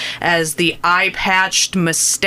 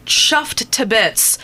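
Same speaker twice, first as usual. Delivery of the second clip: put-on English accent; 4 semitones higher, much slower than usual. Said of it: "if I may use the English expression".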